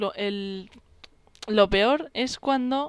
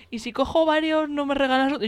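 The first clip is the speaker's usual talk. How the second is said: put-on pushy drunk, a bit louder; drunk customer; 5 semitones higher, 4 dB louder than usual. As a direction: wheedle for a free drink. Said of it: "Lo típico de los borrachos".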